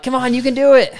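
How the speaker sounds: high loud cheering call; encouraging cheer. Shown as a demonstration. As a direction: shout encouragement on the downhill. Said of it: "So I'm like".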